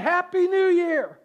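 high, loud New Year cheer; new year countdown crowd. Pretend. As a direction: shout it like a party crowd at midnight. Midnight cheer.

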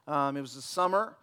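usual speaking voice

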